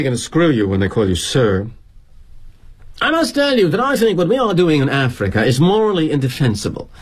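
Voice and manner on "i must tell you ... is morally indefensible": put-on higher, earnest young Brit protesting; British kid. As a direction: lodge a moral protest as a British junior. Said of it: "This Brit kid who works for us".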